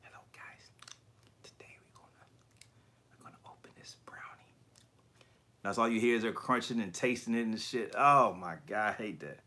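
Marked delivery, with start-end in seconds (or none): 0.0-4.5 hushed, unhurried ASMR host whisper; mock ASMR whisper. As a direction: whisper it, imitating an ASMR video. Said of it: "My wife be watching this woman do that".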